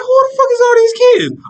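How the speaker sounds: put-on a man finding kids left in a car, shocked outrage. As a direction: shriek the question in shock.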